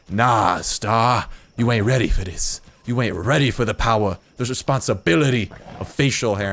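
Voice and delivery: mock scolding from a stubborn beard; bossy talking beard. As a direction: put on a swaggering voice for the beard. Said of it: "The left half's just like".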